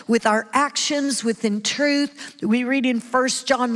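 ordinary talk

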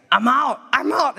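high, slow, alarmed "I'm out"; panicked retreat. Retold or imitated. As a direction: back away in mock panic. Panicked flight from possessed girl.

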